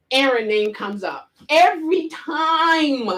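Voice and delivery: usual speaking voice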